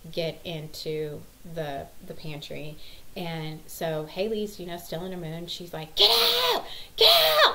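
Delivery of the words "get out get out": loud, very high mock shriek; two piercing high yells; imitated screaming. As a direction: mimic a child screaming at a sibling. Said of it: "like, screaming at my five-year-old".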